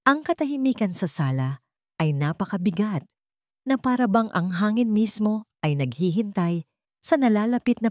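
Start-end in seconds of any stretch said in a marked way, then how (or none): none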